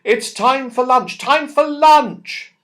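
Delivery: high, loud, drawn-out call. A narrator's reading. Mother calling the children in for lunch, long loud call. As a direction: sing it out across the garden slowly.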